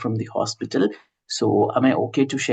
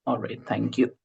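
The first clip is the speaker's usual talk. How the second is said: ordinary talk, nothing marked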